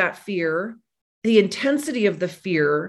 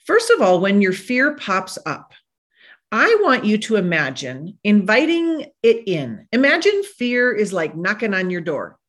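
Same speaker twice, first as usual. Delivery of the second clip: usual speaking voice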